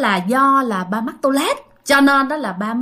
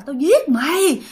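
retold angry yelling; 7 semitones higher than usual. Father cursing at the wall, retold angry yelling. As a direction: act out his raging threats, pitched up. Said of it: "ba cứ la hét nha".

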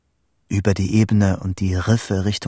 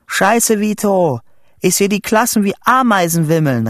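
high, loud disaster report; excited shouting; 11 semitones higher, 4 dB louder than usual. A narrator's reading. Boy describing the inferno to Vito, excited shouting. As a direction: cry out the mounting catastrophe. Shouts imagined catastrophe to friend.

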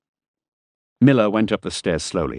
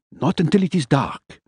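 higher, fussy man's voice; fussy character voice; 9 semitones higher than usual. Acted. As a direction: lecture it in a higher voice. Miller's voice again.

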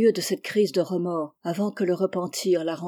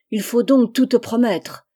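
louder, higher outburst; angry exclamation; 3 semitones higher, 6 dB louder than usual. A narrator's reading. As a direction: cry it out at his accomplice, exasperated. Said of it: "s'écria-t-il à son tour". (s'écrier plus fort et plus aigu, exaspéré)

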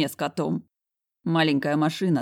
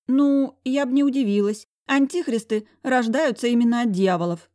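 much higher, snide secretary voice; 8 semitones higher, 4 dB louder than usual. A narrator's reading. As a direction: needle him with a smirk. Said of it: "ехидно хмыкнула".

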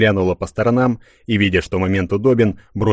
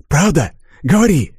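higher, louder urgent demand; fierce demand; 8 semitones higher, 4 dB louder than usual. Acted. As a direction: press her hard for an answer. Lover demands an oath.

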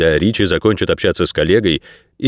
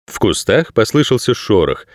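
usual speaking voice

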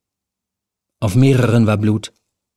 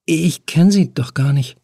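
lightly raised nervous woman's voice; a young woman caught trespassing, higher; Helen, nervous; 6 semitones higher than usual. A narrator's reading. Helen caught in the flat, talking to the stranger, higher and nervous.

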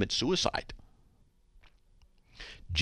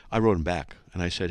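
ordinary talk

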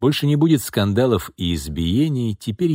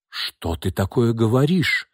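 frightened; 3 semitones higher, slower than usual. A narrator's reading. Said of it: "перепугалась женщина".

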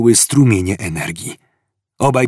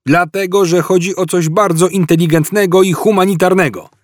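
high-pitched, insistent police ultimatum; calling out; 8 semitones higher than usual. A narrator's reading. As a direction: shout your terms to the fugitives. Cop shouting terms across the hall. (ultimatum wykrzyczane wysokim głosem)

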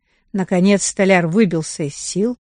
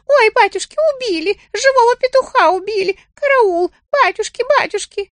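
rooster yelling bloody murder. Acted. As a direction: scream for help as if being killed. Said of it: "заорал благим матом".

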